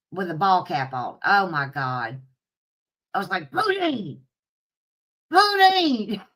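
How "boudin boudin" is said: yelling for a loose dog; calling the dog. Retold or imitated. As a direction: shout the dog's name after him.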